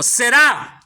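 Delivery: "será" with a strong final A; stress on final A. Shown as a demonstration. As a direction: punch the last syllable of "será". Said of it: "también tiene un acento". ("será" con la A final bien acentuada)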